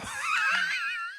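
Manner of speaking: very high, squealing burst of laughter; real surprised laugh-shriek. Real, not acted. Wife shows underwear on air.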